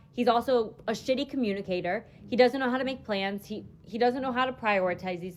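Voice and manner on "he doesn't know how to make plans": ordinary delivery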